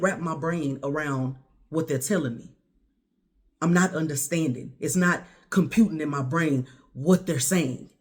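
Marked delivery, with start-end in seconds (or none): none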